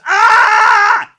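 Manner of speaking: loud high mock scream of a drunk man; imitated scream. Retelling a drunk stranger's scream in the bathroom, loud high yell.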